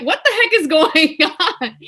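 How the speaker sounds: high, loud, laughing disbelief; amazed. Retold or imitated. To mom as bees fly around them, loudly amazed. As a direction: exclaim it, bewildered and amused.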